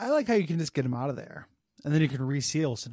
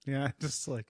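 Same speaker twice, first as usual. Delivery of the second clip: ordinary talk, nothing marked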